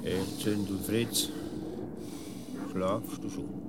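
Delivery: low, soft and slow, careful not to wake anyone; lying in bed at night, softly asking a friend if he sleeps; hushed night call. Acted. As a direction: test in the dark whether he is asleep.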